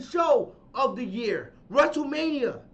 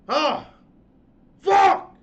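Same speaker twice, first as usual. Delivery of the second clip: loud, high curse; frustrated shout; 5 semitones higher, 8 dB louder than usual. Real, not acted. Host losing it once more, shouting a curse. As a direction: let it burst out. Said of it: "that epic rant".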